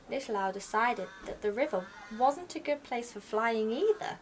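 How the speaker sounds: ordinary delivery, nothing marked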